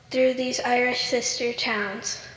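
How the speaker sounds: ordinary talk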